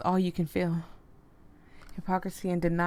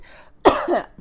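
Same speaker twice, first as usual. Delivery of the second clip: sharp loud cough; a sudden cough mid-read; real cough; 9 semitones higher, 10 dB louder than usual. Real, not acted. Streamer reading a game, coughing loudly. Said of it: "Try not to cough".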